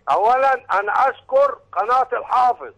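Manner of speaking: distorted caller line on a phone; phone call audio. Real, not acted. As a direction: caller heard through a bad phone line. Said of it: "معلش الصوت مش واضح؟".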